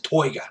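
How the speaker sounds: "toiga", oi vowel and no final R; Aussie oi vowel. Retold or imitated. Quoting a wildlife man's word, "toiga" with Aussie oi. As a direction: say "toiga" for tiger. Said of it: "He always said toiga".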